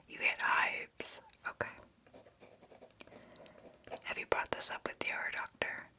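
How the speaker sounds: ordinary delivery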